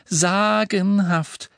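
stretched out slowly, admiring; slow drawn-out praise. A narrator's reading. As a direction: savour the word slowly. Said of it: "sagte Hermine".

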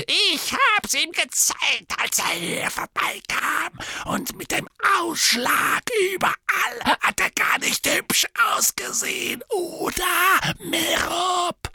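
high, gloating sneer, half-whispered; sneering taunt. A narrator's reading. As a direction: taunt her in a gleeful high voice. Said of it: "keckerte Morphin".

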